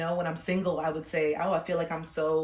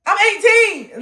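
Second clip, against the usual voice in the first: high loud protesting voice; loud shrill pleading protest; defensive whine; 7 semitones higher, 8 dB louder than usual. Pretend. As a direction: insist you're a child. Arguing back as young self.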